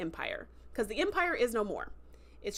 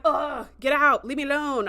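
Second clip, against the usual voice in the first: hammed-up louder ugh and brush-off; mock grumpy groan; 4 semitones higher, 8 dB louder than usual. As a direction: groan it like a brooding teen. Annoyed Jaden shooing friend away.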